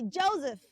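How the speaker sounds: Joseph as "JOE-ziff"; Z sound in the middle of Joseph; correct pronunciation. Shown as a demonstration. Teacher asks children to repeat, Joseph as "JOE-ziff". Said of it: "So it's Joseph".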